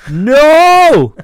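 high, loud panicked shout. Retold or imitated. Father shouting to stop the child in the bath, loud panicked cry.

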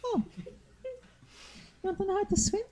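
high, thin ancient voice; frail tiny elder asking; very old faint voice. Acted. GM voices Shiwa.

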